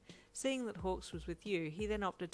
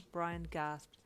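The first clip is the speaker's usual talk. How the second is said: ordinary delivery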